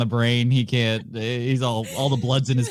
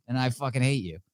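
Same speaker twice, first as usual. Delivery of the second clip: ordinary delivery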